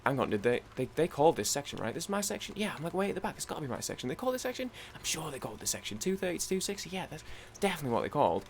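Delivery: soft, unsteady-pitched anxious muttering; second-guess yourself under your breath; nervous self-reassurance. Retold or imitated. Traveller checking their ticket section, nervous. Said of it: "getting, like, nervous".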